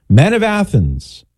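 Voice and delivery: orator's opening cry, high and slow; high-pitched, drawn-out public address; Saint Paul. Acted. Paul hailing the Athenians in the street, high oratorical call. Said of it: "his famous opening words of his soliloquy".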